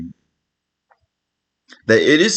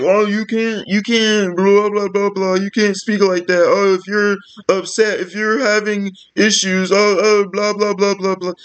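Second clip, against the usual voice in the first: higher, droning blah-blah mimicry; mock manic bank rep; 5 semitones higher than usual. Mimicking the bank person refusing to listen, mock manic voice. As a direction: imitate a frantic rep in a raised, running voice. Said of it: "having a manic fucking episode".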